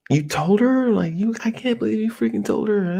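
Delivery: imitating his own frustrated panic; frustrated disbelief. Teen learning his friends told the girl, frustrated disbelief. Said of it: "kind of frustrated and angry and scared".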